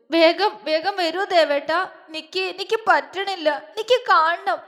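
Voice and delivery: high, halting desperate plea; acted sobbing. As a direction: beg him tearfully to come, words catching. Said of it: "അവൾ തേങ്ങി".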